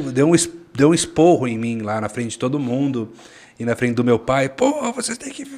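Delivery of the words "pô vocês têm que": scolding boss. Retold or imitated. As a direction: scold them like an angry boss. Boss scolding in retold story.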